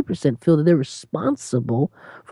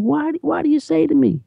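Jesus asking; 6 semitones higher than usual. Acted. Jesus's question voiced by preacher.

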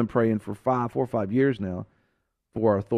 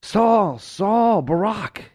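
higher, louder voice of the Lord calling out by name; 7 semitones higher, 3 dB louder than usual. Acted. The Lord calls out to those organizing against him. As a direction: call them by name from heaven.

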